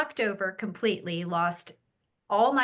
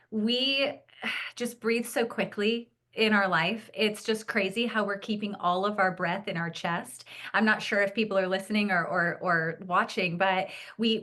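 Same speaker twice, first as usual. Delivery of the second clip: ordinary talk, nothing marked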